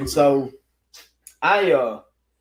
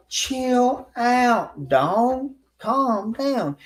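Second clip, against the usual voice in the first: higher, pleading placating; calming him down; 10 semitones higher, much slower than usual. Retold or imitated. The narrator telling the angry man to calm down. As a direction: talk him down gently.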